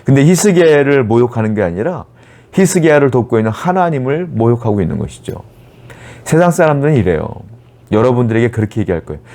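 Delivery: usual speaking voice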